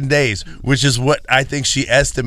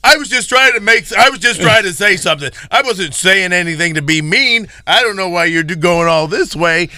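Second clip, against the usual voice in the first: higher, fast flustered protest; frantic mock plea to an angry wife; panicked pleading; 7 semitones higher, 3 dB louder than usual. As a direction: scramble to defend yourself, voice up high. Panicked retelling of self.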